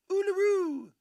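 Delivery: sing-song it way up high; playful high pitch. Pretend. Playing with a fun name.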